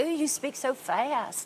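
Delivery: slow, drawn-out Texas drawl; stretched mock Texas twang; imitated Texan. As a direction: mimic a slow-talking Texan. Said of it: "They say".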